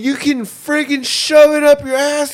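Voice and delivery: high-pitched gamer comeback tirade; Call of Duty trash-talker. Pretend. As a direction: fire back insults in a squeezed-up high voice. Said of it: "A Call of Duty lobby".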